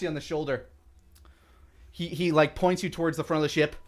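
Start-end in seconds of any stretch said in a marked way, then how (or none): none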